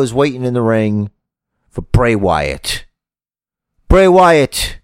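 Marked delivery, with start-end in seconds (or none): 3.9-4.8 loud, higher call-out; a failed shot at Cesaro. Acted. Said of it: "I can't do a Cesaro impression".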